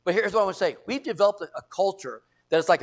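ordinary delivery

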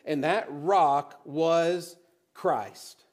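"And that rock was Christ" drawn out very slowly; slow it right down for the reveal; slow, solemn reveal; very slow climax. Shown as a demonstration. Slow climactic scripture reading.

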